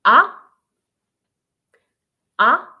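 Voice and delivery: AH rising sharply, like a surprised question; short loud AH sweeping upward; second tone, rising. Shown as a demonstration. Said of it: "rising your tone".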